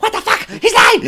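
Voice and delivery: very high-pitched, outraged squeal; mad vendor outburst. Retold or imitated. Choked vendor hearing the cop side with thieves, mad outburst. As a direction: imitate a vendor squawking in disbelief. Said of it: "he was so mad".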